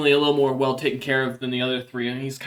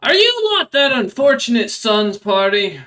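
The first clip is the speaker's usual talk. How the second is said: loud, raised-pitch troll boss challenge; Red Maw; 7 semitones higher, 6 dB louder than usual. Acted. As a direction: call across the tavern at two strangers. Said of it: "as Red Maw kinda stands up".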